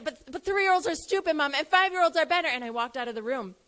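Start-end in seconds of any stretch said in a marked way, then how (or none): 0.0-2.5 put-on high, loud upset child wail; child losing it. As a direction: voice the boy's frantic protest. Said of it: "he started losing it".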